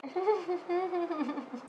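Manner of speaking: quiet amused giggle; soft laugh. Real, not acted. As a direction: laugh softly at yourself. Laughs at looking like egg.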